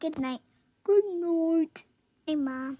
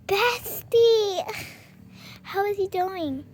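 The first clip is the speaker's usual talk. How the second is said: ordinary talk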